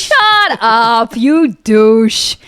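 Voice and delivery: drawn-out, high-pitched scornful outburst; mock telling-off. Host shutting up the guy who'd punch a drill sergeant, scornful. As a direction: shoot down a braggart slowly and scornfully.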